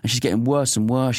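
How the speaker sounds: ordinary talk, nothing marked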